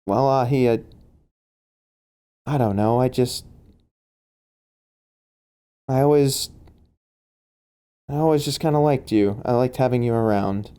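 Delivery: halting confession with long pauses; awkward, hesitant admission. Acted. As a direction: admit it haltingly, as if avoiding their eyes. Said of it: "looking at the floor away from you".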